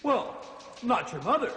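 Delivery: usual speaking voice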